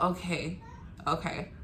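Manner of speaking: quiet, understated acceptance; dazed, retold. Dazed mother told about her son's NICU trip, softly. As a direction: say it softly, half out of it. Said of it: "I'm, like, out of it".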